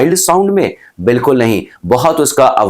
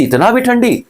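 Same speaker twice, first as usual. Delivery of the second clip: incredulous; 11 semitones higher than usual. Acted. Teacher exclaims at the cold.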